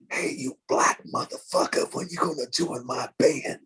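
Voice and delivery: whispered raspy imitation of [name]. [name] phones the drummer, whispered rasp.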